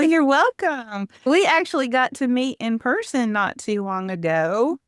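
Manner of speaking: ordinary talk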